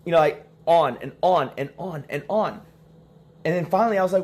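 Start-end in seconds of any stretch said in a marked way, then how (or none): none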